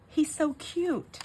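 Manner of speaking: put-on high, drawn-out little-kid cooing; toddler. Toddler describing the ghost to mom, high adoring child voice. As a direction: gush slowly like a small child. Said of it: "She goes".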